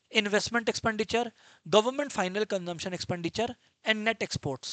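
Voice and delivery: ordinary talk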